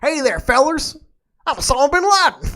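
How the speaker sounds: silly high Texas accent impression; Osama bin Laden with a Texas accent. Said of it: "like a Texas accent".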